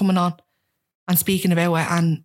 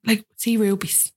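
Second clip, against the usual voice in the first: ordinary talk, nothing marked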